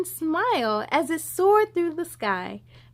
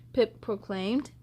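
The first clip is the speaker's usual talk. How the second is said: plain reading voice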